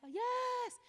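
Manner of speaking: high, loud squeaky yes; mock high yes. Speaker mimicking the petitioner's eager yes.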